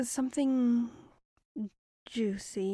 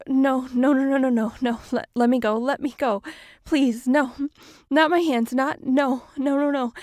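loud, frantic begging; panicked pleading; 2 semitones higher, 11 dB louder, faster than usual. Acted. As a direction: beg for release in panic. Victim being bound, pleading.

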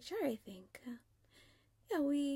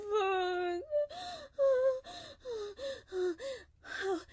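much higher, louder cries breaking into sobs; climax cries; 10 semitones higher, 12 dB louder than usual. Acted. Woman crying out to her partner at the height, breaking. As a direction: let it peak and spill over.

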